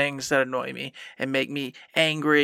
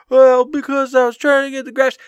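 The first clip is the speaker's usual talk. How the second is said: high, louder whiny mock excuse; 9 semitones higher, 6 dB louder than usual. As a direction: parrot a feeble excuse up high. Mocking own past excuses.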